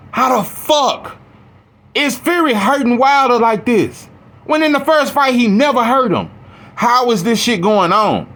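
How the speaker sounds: high, worked-up mock outrage at the fight; retold disbelieving anger. Telling his brother about the Wilder-Fury fight, high, worked-up outrage. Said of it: "And I was so mad".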